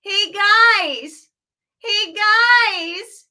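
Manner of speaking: full whinge, high and loud; whinged up. Shown as a demonstration. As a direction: whinge it like a nagging child. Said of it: "can you whinge that up?".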